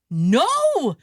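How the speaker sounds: a loud, dismayed No; dismayed cry. Retold or imitated. Test-taker crying out as his iPad crashes.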